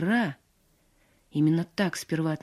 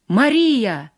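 louder, higher cry of the name; shouted call; 4 semitones higher, 7 dB louder than usual. A narrator's reading. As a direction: call out after someone, voice raised. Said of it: "крикнул Иван". (громкий крик вдогонку)

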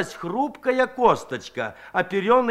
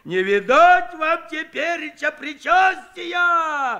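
high, slow, loud hammed-up wail; mock horror; 10 semitones higher, 4 dB louder, slower than usual. A narrator's reading. Kuzhenkov teases the women, shouting in mock horror. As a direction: ham up the terror. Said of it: "В притворном ужасе закричал Куженков".

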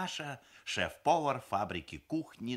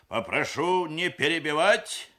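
loud, high, stern reprimand; strict; 8 semitones higher, 11 dB louder than usual. A narrator's reading. Papa chairing, rebuking the interrupting aunt, strictly. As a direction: shut the interrupter down officiously. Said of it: "строго сказал папаша".

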